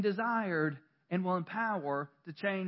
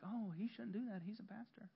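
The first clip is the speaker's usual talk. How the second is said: much softer, tutting aside; judging hush; 18 dB quieter than usual. Retold or imitated. Churchgoer judging the pastor's misstep, soft tutting. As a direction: whisper the judgment like gossip. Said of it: "you'd be like".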